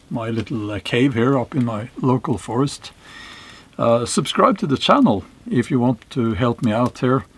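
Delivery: ordinary talk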